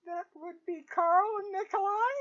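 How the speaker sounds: high voice rising as if unsure; timid cook. Acted. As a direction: answer like a nervous servant. GM voices timid cook answering questions.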